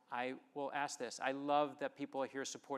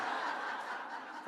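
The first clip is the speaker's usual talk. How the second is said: airy laugh. Real, not acted. Laughs at own story.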